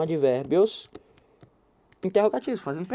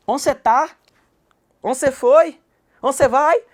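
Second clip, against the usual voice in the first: loud, higher Goiás-style questions, dropping the a of aonde; Goiás way of talking; 6 semitones higher, 9 dB louder, slower than usual. Shown as a demonstration. Teacher demonstrating how people from Goiás talk. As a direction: say Onde cê tá with a lively Goiás twang. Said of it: "Fala assim, ó".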